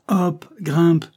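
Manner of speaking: loud, high command; sharp circus call to climb; barked order. A narrator's reading. Banquiste sends injured child back up, barked order.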